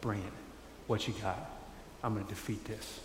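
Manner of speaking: understated determined challenge to the snake; quiet challenge. Retold or imitated. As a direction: say it low like a calm fighter.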